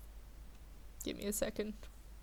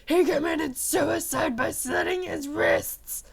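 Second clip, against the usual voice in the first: higher, louder sobbing announcement; upset crying; 5 semitones higher, 8 dB louder than usual. A narrator's reading. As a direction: choke out the bad news through tears. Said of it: "he was really upset".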